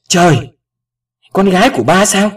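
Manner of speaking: high, loud astonished outburst; stunned exclamation. A narrator's reading. Father learning the caller is his daughter, stunned outburst. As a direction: exclaim in disbelief and emotion.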